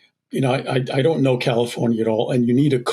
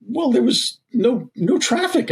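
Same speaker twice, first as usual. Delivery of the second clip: high-pitched protest; 10 semitones higher than usual. Retold or imitated. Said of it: "I said".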